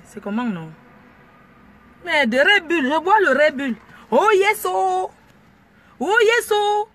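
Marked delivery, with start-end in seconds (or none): 4.1-6.9 high, loud oh yes; excited whoop. Acted. Driver whooping to her music, excited. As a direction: cheer it like a fan in the crowd.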